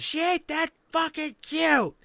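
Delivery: high, loud mock-angry yell. Mother repeating the insult when challenged, angry mock yell. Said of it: "this angry voice saying".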